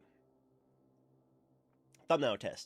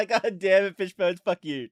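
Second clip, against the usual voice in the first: shouted through laughter, much louder; laughing curse; 9 semitones higher, 14 dB louder than usual. Real, not acted. Streamer yelling at Fishbones through laughter. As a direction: yell it while cracking up.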